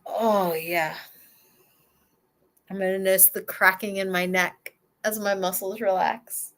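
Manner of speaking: usual speaking voice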